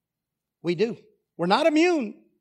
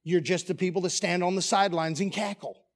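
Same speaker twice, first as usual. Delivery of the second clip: ordinary talk, nothing marked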